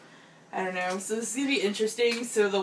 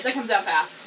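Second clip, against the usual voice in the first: usual speaking voice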